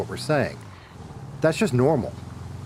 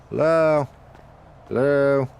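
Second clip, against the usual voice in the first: calling out; 3 semitones higher, 3 dB louder, slower than usual. Acted. Calling out at a door.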